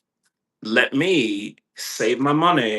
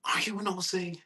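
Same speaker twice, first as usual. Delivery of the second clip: cooing to dog; 8 semitones higher, 8 dB quieter than usual. Real, not acted. Talking to his dog.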